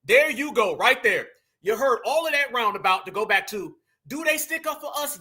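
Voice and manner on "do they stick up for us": high, soft mock of her voice; mimicking her question. Echoing the woman's question in a higher, softer mock voice.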